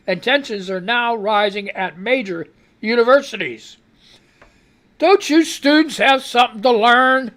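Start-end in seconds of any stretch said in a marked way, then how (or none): 5.0-7.3 scolding. Real, not acted. Scolding protesting students.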